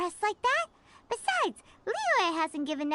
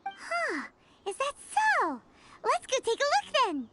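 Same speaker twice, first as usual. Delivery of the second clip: excited; 7 semitones higher than usual. Acted. Eager to go see the training.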